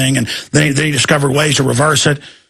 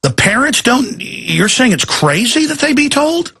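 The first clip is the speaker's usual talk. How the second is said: higher-pitched incredulous questioning; shocked anchor; 6 semitones higher than usual. Retold or imitated. Anchor questioning an official about parents not being told, incredulous. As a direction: mimic an anchor in disbelief, voice lifted. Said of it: "anchor, she says".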